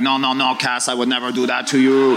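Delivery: lightly imitated Burrows accent, pleading denial; a mock Burrows accent swearing innocence. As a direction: teammate protesting in a half-done Burrows accent. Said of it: "He cannot do a Burrows conversation without the accent".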